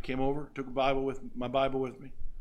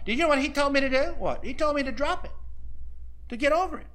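indignant woman; 7 semitones higher, faster than usual. Retold or imitated. Woman complaining to pastor's wife.

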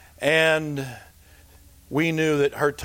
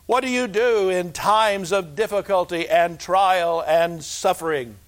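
rising preaching; 5 semitones higher than usual. Acted. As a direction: lift it back into the sermon. Rhetorical questions rising to preaching.

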